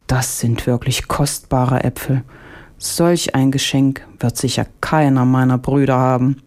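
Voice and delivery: ordinary delivery, nothing marked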